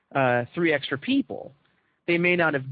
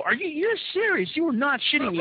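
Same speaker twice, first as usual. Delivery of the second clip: can't believe what he's hearing, much higher; shocked disbelief; 10 semitones higher than usual. Retold or imitated. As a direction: pitch shoots way up in stunned disbelief. Stunned reply to friend's reveal.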